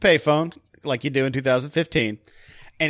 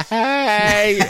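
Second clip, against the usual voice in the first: a higher, louder drawled "Hey"; mock Southern hey; 7 semitones higher, 5 dB louder than usual. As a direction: lightly mimic the actor's one line. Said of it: "it better just be a".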